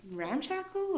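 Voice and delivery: "ramshackle?" rising high in disbelief; a surprised high question. Retold or imitated. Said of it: "I was like, "Oh my God".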